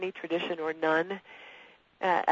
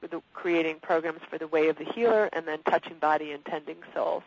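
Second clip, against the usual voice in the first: ordinary talk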